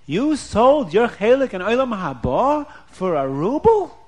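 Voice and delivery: acted outrage, rising high and loud; indignant scolding with incredulous questions; upset, angry wife. A narrator's reading. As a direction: start stunned, then scold in a high, loud voice. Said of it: "Is she angry at him?".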